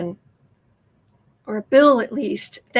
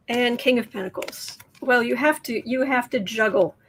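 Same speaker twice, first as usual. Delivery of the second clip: ordinary delivery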